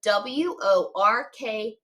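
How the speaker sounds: letters spaced out slowly; slow spelling. Shown as a demonstration. Teacher spelling a tricky word slowly for students. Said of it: "sound that it normally does".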